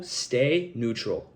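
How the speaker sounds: ordinary talk, nothing marked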